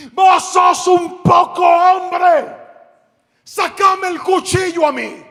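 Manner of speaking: grito agudo, lento y fuerte; desafío furioso. Retold or imitated. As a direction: reta al ladrón a gritos. Pastor desafía al ladrón. (el pastor le grita furioso al ladrón)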